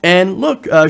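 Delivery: normal talking voice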